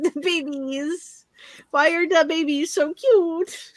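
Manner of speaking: high, slow cooing over pets; cooing baby talk. Acted.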